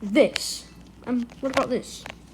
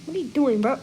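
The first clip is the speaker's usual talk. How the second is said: normal talking voice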